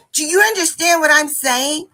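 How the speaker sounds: high, loud demanding question; exasperated retold shout, rising at the end; frustrated yelling. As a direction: imitate a frustrated student yelling. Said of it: "she's yelling at me".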